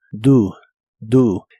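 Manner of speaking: "Do" spoken as "du", a short rounded oo vowel. Shown as a demonstration. Teacher modelling "Do" as "du" for Spanish learners. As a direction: model "Do" clearly as "du", twice. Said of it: "lo pronuncias como si la O fuese una U".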